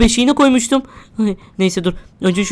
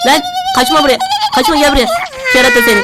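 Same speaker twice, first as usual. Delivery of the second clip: high squealing yells after it; frantic squeal; faster than usual. Real, not acted. Babysitter chases the runaway diaper, shrieking high. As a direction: shriek while chasing something.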